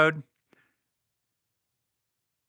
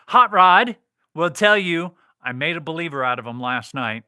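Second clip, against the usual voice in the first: ordinary talk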